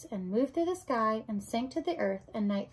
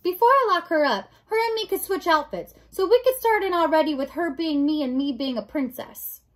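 put-on high, loud serving-girl voice; Mig, eager serving girl; 6 semitones higher, 7 dB louder than usual. Mig plans to switch outfits with the princess, eager serving-girl voice. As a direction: speak up as a keen, simple servant.

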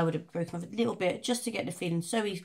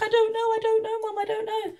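much higher, fading helpless repeats; dazed son; 6 dB louder than usual. Retold or imitated. Son answering his mum, high and confused. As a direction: trail off like a lost scared kid. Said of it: "He's like".

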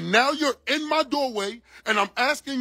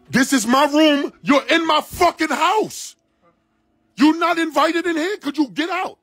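real rising anger; 7 semitones higher than usual. Real, not acted. Man ordering police out of his house, pitch-raised real anger. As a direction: voice climbing much higher, strained and insistent.

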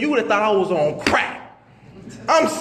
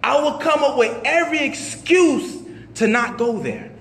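usual speaking voice

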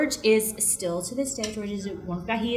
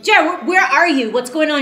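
alarmed demand; 8 semitones higher, 10 dB louder than usual. Retold or imitated. Calling the brother in alarm.

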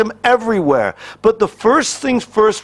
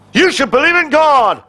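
mock preacher shout; 5 semitones higher than usual. Preacher parodied to a congregation, mock hellfire shout. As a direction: bellow it like a pushy street preacher.

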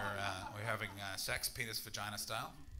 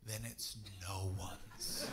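lower, slow drawn-out payoff; slow deadpan punchline; 5 semitones lower, 5 dB quieter, much slower than usual. Acted. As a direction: drag out then it's no one's, low and slow.

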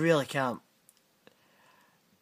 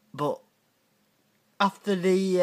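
ordinary talk

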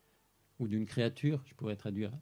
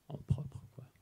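whispered aside; 4 semitones higher than usual. Real, not acted. Teacher explaining possession to students, whispered. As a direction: drop to a whisper to make it private. Teacher hushes a key phrase.

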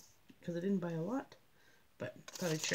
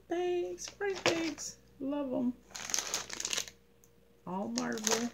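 excited; 8 semitones higher than usual. Real, not acted. Excited about surprise bags.

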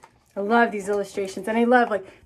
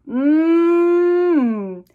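higher, louder hum of delight; savoring mmm; 6 semitones higher, 6 dB louder than usual. Acted. Melba smelling fried bakes, a higher louder delighted hum. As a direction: hum like smelling good food.

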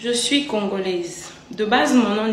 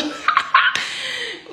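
aigu éclat de rire. Real, not acted. Rit après sa démonstration.

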